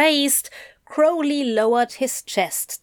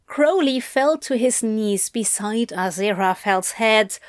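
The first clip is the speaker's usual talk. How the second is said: plain reading voice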